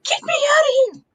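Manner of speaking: high, rushed imitation of panic; squealed fake-hysterical plea; mock scream. Imitating Bianca pounding on glass, mock screaming. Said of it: "She's screaming".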